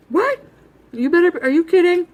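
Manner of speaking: high, loud, frantic disbelief rising to a yell; a mom shrieking at her teen in shock; freaking-out mom. Retold or imitated. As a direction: play the panicked mother losing it. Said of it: "She was freaking out".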